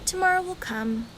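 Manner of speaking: plain reading voice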